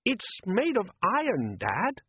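put-on high young boy's voice, uneasy; a worried son asking his dad; boy Les. A narrator's reading. Son Les doubting his father at sea, high uneasy boy voice.

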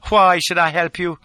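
high, loud, icy challenge; very cold. A narrator's reading. Lola refusing to help the fugitive, cold. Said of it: "says she, very cold".

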